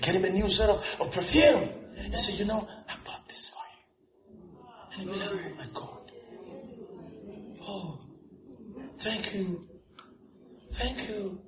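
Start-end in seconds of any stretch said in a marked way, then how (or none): 2.3-11.4 very soft, half-whispered exchange; hushed gift-giving. Acted. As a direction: act out a humble, hushed thank-you. Acts out tender gift and thanks.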